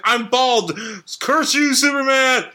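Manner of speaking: cartoon villain. Villain cursing hero after scalping.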